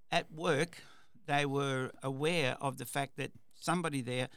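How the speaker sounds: usual speaking voice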